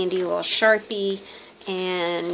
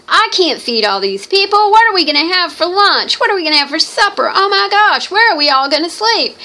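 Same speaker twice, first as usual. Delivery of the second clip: higher, louder panicked hostess; frantic panic; 10 semitones higher, 6 dB louder, faster than usual. Acted. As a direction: fret aloud as the hostess who can't feed everyone. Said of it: "Martha is, like, freaking out".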